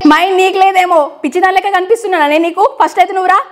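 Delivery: high-pitched, indignant prank outburst; put-on fury. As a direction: lash out down the phone, pretending rage. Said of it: "నేను fire మీద మాట్లాడినా".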